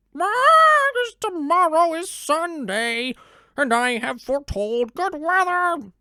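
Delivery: very high, loud squawking rooster; a panicked rooster crying out; frantic rooster. Acted. The rooster explains his crowing to the donkey, high and frantic.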